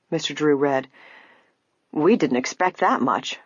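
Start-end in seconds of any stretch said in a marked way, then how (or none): none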